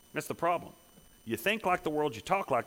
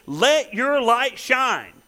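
slow, higher and louder, each word pressed; emphatic command; 8 semitones higher, 6 dB louder, much slower than usual. Acted. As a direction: drive it home slowly. Preacher voicing Jesus' command.

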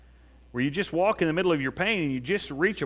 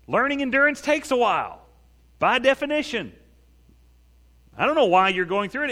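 high, raised pulpit voice; emphatic preaching; 9 semitones higher, 3 dB louder than usual. Real, not acted. Preacher tells congregation endurance takes time, high emphatic preaching. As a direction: drive the point home at a high pitch.

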